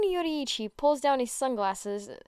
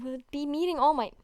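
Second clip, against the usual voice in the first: usual speaking voice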